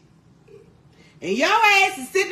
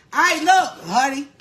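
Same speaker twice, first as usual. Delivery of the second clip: ordinary talk, nothing marked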